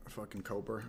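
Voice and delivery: ordinary talk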